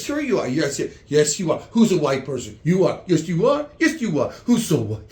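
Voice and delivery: mock cooing, as if praising a pet; sing-song humouring with swooping pitch; indulgent European liberal. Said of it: "Europeans are so liberal. It says".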